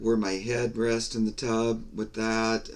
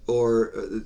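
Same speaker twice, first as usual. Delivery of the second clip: ordinary talk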